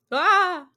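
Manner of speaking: high, loud fake jump-scare scream; mock scream. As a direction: shriek as if startled, hammed-up. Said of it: "Very scary".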